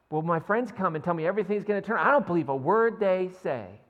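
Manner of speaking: loud, fast, bitter complaint; bitter outburst. Acted. Preacher voicing the psalmist's distrust, loud and bitter. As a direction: spit out the distrust.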